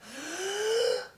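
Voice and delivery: big inhaled gasp; deep gasp. A narrator's reading. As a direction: suck in a huge breath. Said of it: "So he took a deep breath".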